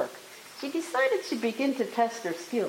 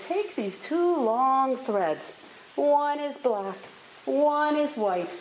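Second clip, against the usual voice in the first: slow, higher, measured instruction; instructing storybook mistress; 6 semitones higher, 4 dB louder, much slower than usual. A narrator's reading. Mother Holla setting the girl a task, slow and high. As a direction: lay out each item slowly on a raised pitch.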